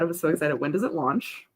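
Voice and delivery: normal talking voice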